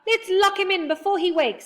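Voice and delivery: high, loud hurry; urgent high call. A narrator's reading. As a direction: rush them to act. Urgent plan.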